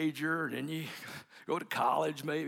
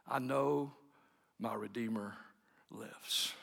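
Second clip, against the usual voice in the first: drops to a hush, last word whispered; soft reverent fade to whisper; fading to a whisper; 6 semitones lower, 7 dB quieter, slower than usual. Shown as a demonstration. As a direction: let the words fall away into a breath. Quote fades to whisper.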